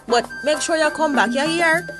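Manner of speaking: normal talking voice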